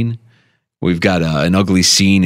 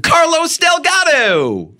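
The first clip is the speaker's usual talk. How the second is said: high, slow, drawn-out shouted name; announcer shout; much slower than usual. Acted. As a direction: call it like a ballpark announcer.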